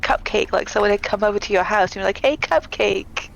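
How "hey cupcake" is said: usual speaking voice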